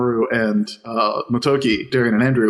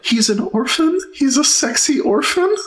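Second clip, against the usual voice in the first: swooning mock gasp; 12 semitones higher than usual. Mocking Ray's crush.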